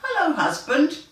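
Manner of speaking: a bright hello to her new husband; lightly voiced wife. New wife greets Old MacDonald, lightly voiced cheery hello. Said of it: "she said".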